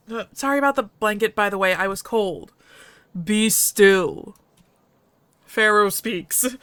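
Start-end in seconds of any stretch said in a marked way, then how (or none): 3.2-6.6 a hint of a gruff alien dog-man; slow, heavy foreign-sounding voice; gruff alien husky. The husky speaking to the stranger, lightly gruff.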